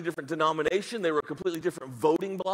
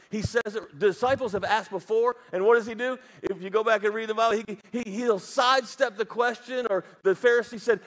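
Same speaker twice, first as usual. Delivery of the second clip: ordinary talk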